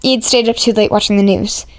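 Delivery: ordinary delivery, nothing marked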